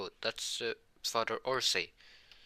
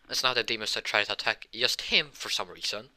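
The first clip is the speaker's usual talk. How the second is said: ordinary delivery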